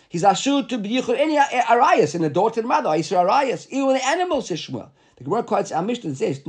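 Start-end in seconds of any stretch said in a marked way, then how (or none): 0.1-4.5 raised-pitch, insistent ruling; emphatic objection. Acted. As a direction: argue the objection, pitched well up. Acts out Shmuel's emphatic objection.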